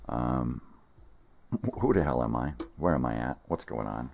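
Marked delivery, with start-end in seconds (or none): none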